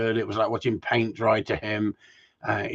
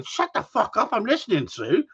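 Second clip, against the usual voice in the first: high mock snap, shut up I'm listening; pitched-up fake rant at his wife; 9 semitones higher than usual. Joking to the viewers about snapping at his wife, high mock snap. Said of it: "I'd be turning around going".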